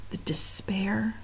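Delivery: hushed, breathy whisper of dread; unvoiced spooky hush. A narrator's reading. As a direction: breathe it like a secret.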